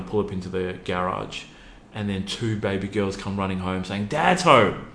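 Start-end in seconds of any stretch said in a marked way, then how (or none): 4.1-4.9 put-on higher, louder cheerful child's call; kids calling out. Baby girls greeting dad in the garage, excited child call. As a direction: call it like an excited little girl.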